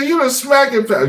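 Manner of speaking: high, breaking up on the punchline; gleeful high mock recognition; laughing imitation. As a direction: squeal out the stranger's line through laughter. Punchline repeated while laughing.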